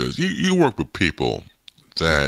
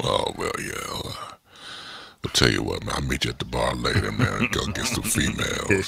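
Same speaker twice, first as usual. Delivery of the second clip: low, soft, slow radio croon; mock late-night lover-man voice; slow jam DJ; 5 semitones lower, 6 dB quieter than usual. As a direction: keep up the smooth off-mic DJ act. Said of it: "you don't have to talk like that now".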